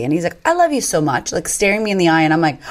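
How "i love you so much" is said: put-on high-pitched small child voice; mimicked little boy, pitched up; little son. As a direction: imitate a three-year-old's earnest declaration. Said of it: "he's, like, three".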